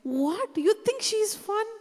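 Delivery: sharp, high mocking question; mock the very idea; scoffing disbelief. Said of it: "she's like".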